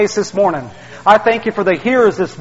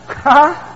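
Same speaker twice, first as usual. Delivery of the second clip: high little laugh; 7 semitones higher than usual. Real, not acted. Laughs about his old sports fandom.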